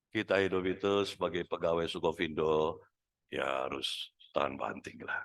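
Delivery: limp wrong voice. Shown as a demonstration. Manager urges Sukovindo staff to be tough in a limp, low voice. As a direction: mumble the pep talk lifelessly. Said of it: "salah suara".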